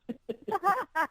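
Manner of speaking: high bright laugh. Real, not acted. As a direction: laugh at your own joke. Laughing at her own accent joke.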